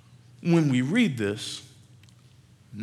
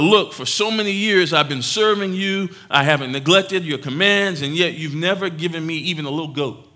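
resentful protest; 4 semitones higher, 6 dB louder than usual. Acted. Older son answering his father.

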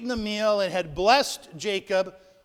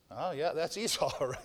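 put-on fooled old father, chuckling; 5 semitones lower, 7 dB quieter than usual. Blind Isaac fooled, voiced.

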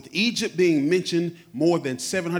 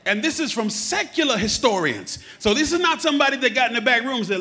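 ordinary talk, nothing marked

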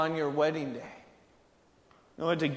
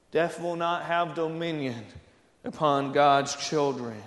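normal talking voice

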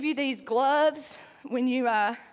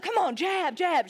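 high mock coach calls; retold instructor's yell, pitched up; imitated yelling; 5 semitones higher than usual. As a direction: spur on a flagging boxer, lightly yelled. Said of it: "She's yelling at me".

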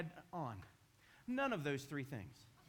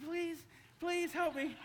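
high, slow desperate plea; pleading in pain; 13 semitones higher, 3 dB louder, slower than usual. Retold or imitated. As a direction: beg for help, voice strained high. Begging neighbor in pain.

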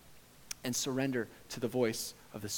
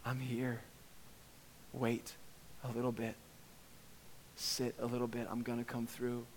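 confident, loving Father; 2 semitones lower, 9 dB quieter than usual. Acted. Father tells his child to wait, lovingly. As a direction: settle them gently, with long pauses. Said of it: "sure, confident, loving face of your Father".

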